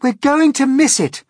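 a high, loud excited shout. Acted. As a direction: yell it in alarm. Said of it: "shouted the ladybird".

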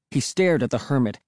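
ordinary delivery

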